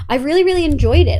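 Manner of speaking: loud, fast gush; enthusiastic. Real, not acted. Streamer enthuses to chat about fighters, loud and fast. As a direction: gush about what you love.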